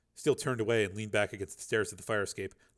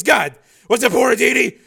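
shouting; 14 semitones higher, 16 dB louder than usual. Acted. Shouted interrogation.

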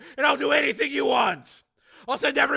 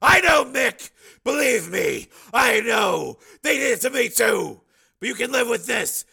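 high, loud, desperate pleading; shouted plea; 12 semitones higher, 13 dB louder, slower than usual. Acted. Man begs sick friend to come back, shouted plea. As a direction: call out urgently to get through to him.

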